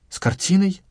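высоко, с недоумением; переспросить, не веря ушам; удивлённый переспрос. Acted. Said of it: "переспросил Старыгин". (удивлённо переспросить)

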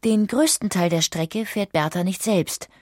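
normal talking voice

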